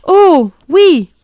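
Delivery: high, loud eager burst; a boy eagerly agreeing with his teacher, high and loud; eager yes. A narrator's reading. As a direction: blurt it out thrilled.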